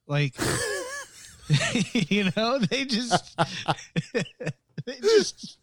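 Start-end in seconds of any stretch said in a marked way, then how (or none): 0.4-5.6 laughing. Real, not acted. Laughing mid-sentence.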